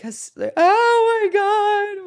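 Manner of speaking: high, loud, slow sob-wail; retelling her own crying, high, loud and slow; tearful wail. Retold or imitated. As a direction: wail it out through happy tears. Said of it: "I'm like".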